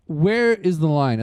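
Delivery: ordinary talk, nothing marked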